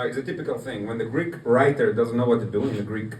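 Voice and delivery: ordinary talk, nothing marked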